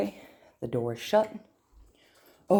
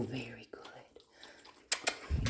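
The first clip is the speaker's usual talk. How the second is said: whispered praise; 5 semitones lower, 14 dB quieter, much slower than usual. Real, not acted.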